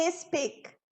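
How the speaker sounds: "Speak" split as IS-peak; two-syllable wrong SPEAK. Shown as a demonstration. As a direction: say "Speak" with a vowel before the S. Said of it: "Ficam duas sílabas". ("Speak" em duas sílabas, "ispik")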